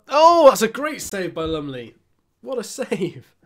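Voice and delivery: loud high "oh" easing into praise; excited commentary. Real, not acted. Gamer sees the keeper save Magoma's shot, excited commentary. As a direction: burst out high, then settle in admiration.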